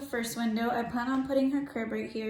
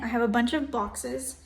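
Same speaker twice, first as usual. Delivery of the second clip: ordinary talk, nothing marked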